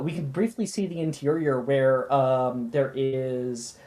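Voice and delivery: ordinary talk, nothing marked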